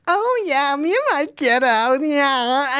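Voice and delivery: put-on high, sugary American accent, lightly done; gushy American accent. Mocking Americans who say kiddo, cutesy American accent. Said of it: "it makes me go inwards".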